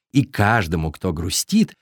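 plain reading voice

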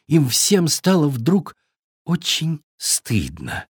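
usual speaking voice

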